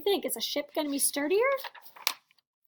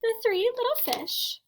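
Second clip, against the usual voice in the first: high, lilting title reading; storybook title; 9 semitones higher than usual. A narrator's reading. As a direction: announce the little fish lightly and high.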